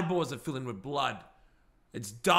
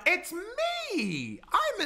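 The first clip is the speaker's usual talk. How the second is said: dramatic reveal; much slower than usual. Acted. Dramatic reveal in back seat.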